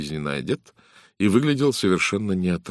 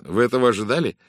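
raised, incredulous question; a touch of disbelief, pitched higher; surprised; 6 semitones higher, 6 dB louder, faster than usual. Acted. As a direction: ask it in mild astonishment. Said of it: "удивлённо спросил Брайан".

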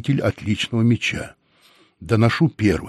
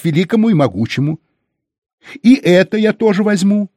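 high, loud, pompous captive; firm, shrill voice; 5 semitones higher, 5 dB louder than usual. A narrator's reading. Captive claims everything they own, firm and shrill. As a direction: lay claim to everything in a raised squealy voice. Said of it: "голос его был твёрд и визглив".

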